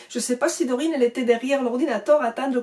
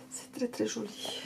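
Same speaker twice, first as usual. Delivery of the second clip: murmure admiratif, presque sans voix; souffle-le comme un secret émerveillé; chuchoté; 14 dB quieter than usual. Real, not acted. Admire la boîte de l'oracle. (chuchotement émerveillé)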